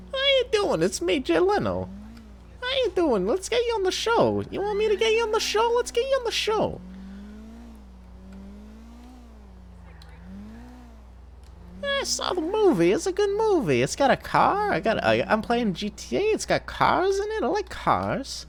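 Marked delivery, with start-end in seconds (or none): 0.1-14.7 very high squeaky Leno voice; Jay Leno impression. Streamer imitating Vinny imitating Jay Leno, very high squeak. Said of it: "me doing an impression of Vinny Vinesauce doing an impression of Jay Leno". 16.2-18.4 very high squeaky Leno voice; Jay Leno impression. Streamer imitating Vinny imitating Jay Leno, very high squeak. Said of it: "impression of Jay Leno".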